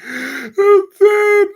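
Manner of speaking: high, loud cry through laughter; laughing. Real, not acted. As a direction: crack up mid-word. Reacting laughing.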